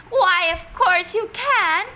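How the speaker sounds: high, loud and slow delighted cry; excited cry. A narrator's reading. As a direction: call out gladly. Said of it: "Missus Hoppy Silver cried".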